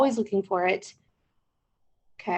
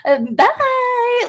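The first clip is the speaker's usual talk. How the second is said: high-pitched, drawn-out goodbye; mocking podcast farewell; mock sign-off; 13 semitones higher, 3 dB louder than usual. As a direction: exaggerate a sing-out bye. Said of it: "for one more second be like".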